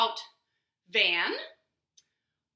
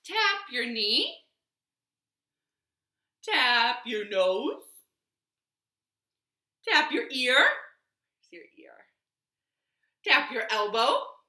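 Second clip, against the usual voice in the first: louder bright movement calls; calling out; 3 semitones higher, 7 dB louder, much slower than usual. Shown as a demonstration. Librarian calling moves to the children. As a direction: call each move out to the room.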